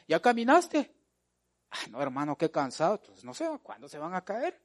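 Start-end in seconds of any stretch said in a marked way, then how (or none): 1.7-4.6 lightly voiced tired church member, softer; mild mock complaint, trailing softer; weary complainer. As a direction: grumble a little, as a tired believer. Acting a tired, doubting church member.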